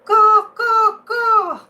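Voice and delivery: high, loud cawing crow call; loud imitated crow caws. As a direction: caw like a crow calling out. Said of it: "if you hear him go".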